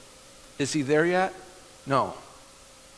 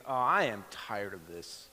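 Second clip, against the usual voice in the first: inner thought; 5 semitones lower, 6 dB quieter than usual. Retold or imitated. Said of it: "And I'm thinking".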